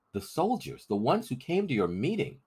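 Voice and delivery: a hint of a frightened boy reporting; boy's voice. Crispin tells the rebels the soldiers' news, lightly boyish.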